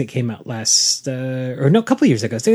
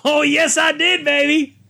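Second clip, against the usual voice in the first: high, exultant cry; thrilled; 11 semitones higher than usual. Real, not acted. Host whose movie quote was finally recognised, high and thrilled. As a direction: crow it high, delighted to be caught out. Delighted that reference was caught.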